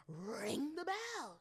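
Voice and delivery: high, drawn-out playful cry; hammed-up call. Telling listeners to hit the bell, hammed-up call. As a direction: call it out slow and high, stretching the words.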